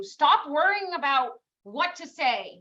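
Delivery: normal talking voice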